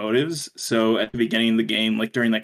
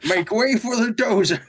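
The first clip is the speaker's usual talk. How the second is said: game soldier voice; 10 semitones higher than usual. Retold or imitated. Quoting Payday voice line.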